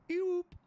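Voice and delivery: loud, high imitated siren blip; police siren whoop. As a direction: mimic a squad car's short whoop. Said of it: "all I hear behind me is".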